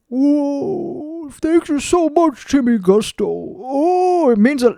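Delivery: high, soft, slow starstruck gush; gushing fan voice. Retold or imitated. Mocking how fans should thank him, high soft gushing voice. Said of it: "don't say it in that fucking voice".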